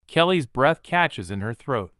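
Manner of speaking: ordinary delivery, nothing marked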